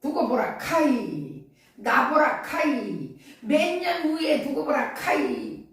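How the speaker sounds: slow, drawn-out Gyeongsang dialect; prophesy it slowly in Gyeongsang dialect. Shown as a demonstration. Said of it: "경상도 말로". (경상도 사투리로 천천히)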